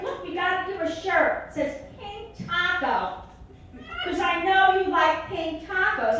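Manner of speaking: mock sweet mother; mother's voice. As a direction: cheerfully present the gift as mom. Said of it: "my mother's like".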